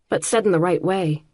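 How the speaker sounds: ordinary delivery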